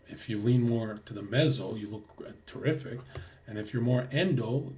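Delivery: ordinary talk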